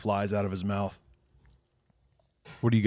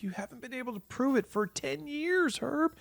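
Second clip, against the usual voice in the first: much higher, lightly voiced tired friend; weary Jack; 3 dB quieter than usual. Jack reminding Herb of ten years, a high weary voice. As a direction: sigh it out wearily. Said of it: "Jack's like".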